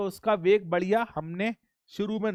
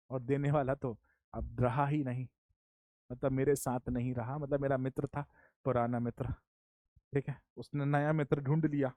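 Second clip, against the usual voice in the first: mock sorrow; 5 semitones lower, 9 dB quieter than usual. Mock-sad aside about old friend.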